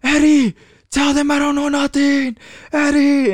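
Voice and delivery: loud, very high-pitched wail to a friend; imitated panicked yelling. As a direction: mimic someone in meltdown, calling out desperately. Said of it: "basically yelling and fucking having a meltdown".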